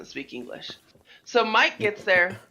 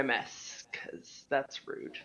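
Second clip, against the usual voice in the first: whisper; 8 semitones lower, 12 dB quieter, much slower than usual. Real, not acted. Guilty aside about littering.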